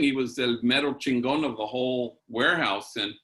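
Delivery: normal talking voice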